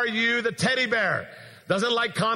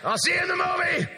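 calling out; 10 semitones higher than usual. Retold or imitated. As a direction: shout it over to him from far off. Wife calling across the lobby.

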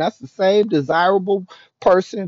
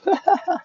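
quick burst of laughter, pitched up high; high short laugh. Real, not acted. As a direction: let a brief high laugh escape. Laugh between sentences.

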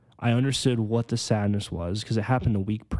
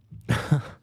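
short laugh; 4 semitones higher than usual. Real, not acted. Laughs at own rambling.

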